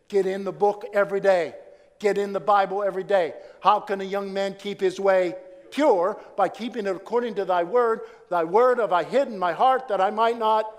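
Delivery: loud, high exhortation; full-force preaching. Acted. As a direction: urge the church to read daily.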